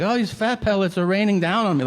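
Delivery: high, loud hammed-up complaint about the weather; mock dramatic lament. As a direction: play it like a melodramatic victim. Mock lament about fat pellets.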